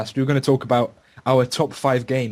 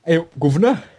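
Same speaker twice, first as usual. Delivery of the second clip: mock Cockney greeting, louder and higher; Cockney accent; 5 semitones higher, 5 dB louder than usual. Host demonstrating the Cockney accent Londoners use. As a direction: tip an imaginary cap, London street style. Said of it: "like, the accent that, um, Londoners use".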